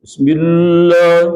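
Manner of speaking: Bismillah with a swallowed first syllable, slow; common mistake. Shown as a demonstration. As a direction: clip the start of Bismillah. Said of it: "ada yang sering keliru".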